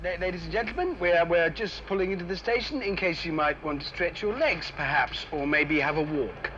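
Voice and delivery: polite PA announcement voice; train announcer. Acted. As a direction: announce it over the tannoy. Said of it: "That's my job!".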